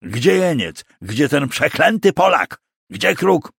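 high, frantic demands, rising in pitch; panicked, furious commander; desperate shouting. A narrator's reading. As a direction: shout desperately for a lost prisoner. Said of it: "krzyknął rozpaczliwie Diber".

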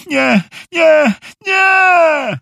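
high, loud wail of refusal; protesting pillow voice. A narrator's reading. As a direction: whine a drawn-out refusal from under the pillow. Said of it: "odparła poduszka". (wysoki, przeciągły protest spod poduszki)

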